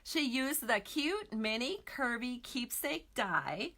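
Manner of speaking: careful retry. Real, not acted. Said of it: "Let me try that again".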